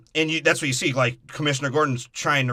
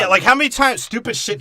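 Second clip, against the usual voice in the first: high, loud exasperated outburst; 10 semitones higher, 6 dB louder than usual. Real, not acted. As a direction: burst out in disbelief.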